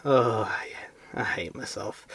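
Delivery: breathy whispered self-loathing. Shown as a demonstration. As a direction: whisper it like a hungover groan. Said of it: "but I'm gonna give you one".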